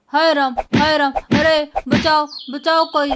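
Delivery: loud panicked cry for help. Acted. Lala ji attacked by the bull Nandi, loud panicked cries for help. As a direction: yell for rescue as the bull charges. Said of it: "लाला जी पर टूट पड़ता है".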